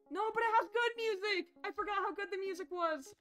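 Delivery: high, bright gushing over the music; excited gushing. Real, not acted. Streamer to viewers about the music, high excited gush. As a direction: rave about the soundtrack.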